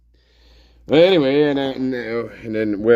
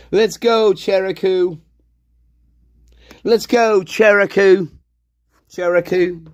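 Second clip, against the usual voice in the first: excited calling out; 8 semitones higher than usual. Pretend. Hyping himself up, calling out.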